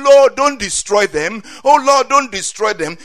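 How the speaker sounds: loud pleading cry to God, Lord don't destroy them; Moses pleading. Retold or imitated. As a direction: beg loudly like Moses for the people. Preacher voices Moses pleading.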